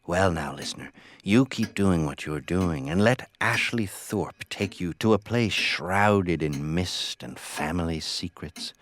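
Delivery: ordinary delivery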